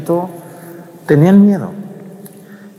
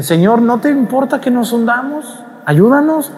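desperate plea; 11 semitones higher than usual. Retold or imitated. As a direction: beg the master to save them. Apostles begging Jesus.

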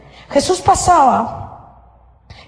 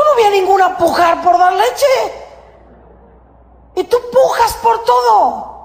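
shouted reproach; 10 semitones higher than usual. Real, not acted. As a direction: throw the accusation at them. Preacher shouting at listener. (grito de reproche)

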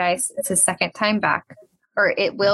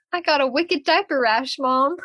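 a higher, badly faked Boston accent; half-hearted Boston accent, pitched up for a child; attempted Boston accent; 6 semitones higher than usual. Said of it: "I can't do the accent".

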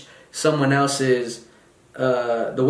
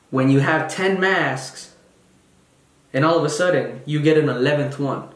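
ordinary delivery

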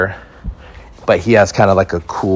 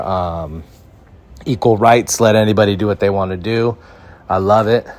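normal talking voice

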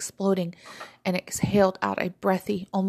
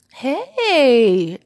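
high, very loud excited greeting; crying out; 6 semitones higher, 10 dB louder than usual. A narrator's reading. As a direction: call it out long and loud. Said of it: "Fizzy cried out".

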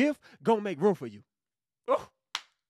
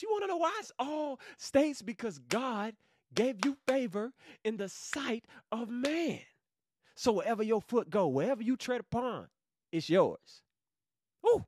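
ordinary talk, nothing marked

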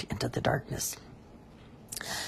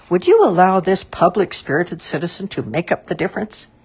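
raised, louder public offer; ingratiating offer; 4 semitones higher, 7 dB louder than usual. A narrator's reading. Mister Grape offers to pay the difference, ingratiatingly. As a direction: pitch it up, eager to win favour.

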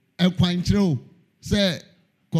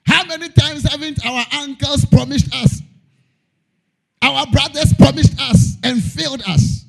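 animated preaching; 6 semitones higher, 4 dB louder than usual. Acted.

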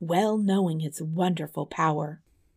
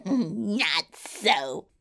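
swooping little villain voice; storybook villain, drawn out; mocking yellow dwarf. A narrator's reading. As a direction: taunt as a wicked dwarf. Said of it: "replied the yellow dwarf".

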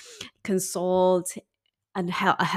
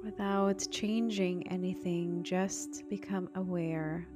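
soft spa whisper; 7 dB quieter than usual. Acted. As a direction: breathe the words softly, spa calm. Meditation breathing guidance.

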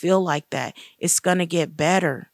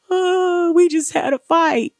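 imitating tearful friend; 11 semitones higher, 5 dB louder than usual. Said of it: "she busted out crying".

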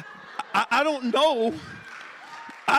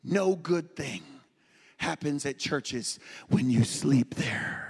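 ominous low; 8 semitones lower, slower than usual. Pretend. Ominous church warning.

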